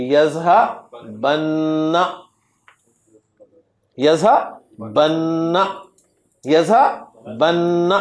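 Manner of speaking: یذہبن said very slowly with a long held نّ at the end; teacher modelling یذہبن slowly, holding the final نّ; drawn-out doubled nun. Shown as a demonstration. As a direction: stretch یذہبن, leaning on the final نّ. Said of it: "clear ہو گیا؟".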